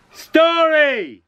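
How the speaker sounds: big high call of the story title; shout. Shown as a demonstration. Storyteller leading a shout with the children, loud. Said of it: "I need you to shout back".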